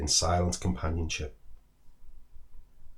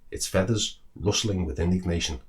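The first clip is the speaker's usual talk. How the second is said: ordinary delivery, nothing marked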